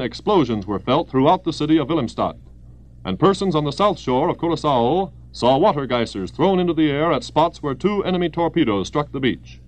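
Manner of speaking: ordinary talk, nothing marked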